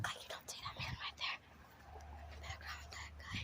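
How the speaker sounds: fast, soft whispered warning. Real, not acted. Whispering to the camera about a creepy man. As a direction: hiss it so the man cannot hear. Stranger nearby, hiding remark.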